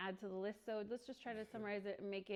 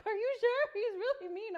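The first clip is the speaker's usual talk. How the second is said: imitating a worried lender, much higher and louder; 12 semitones higher, 9 dB louder than usual. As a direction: play the anxious lender nervously checking in. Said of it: "And the lender's like".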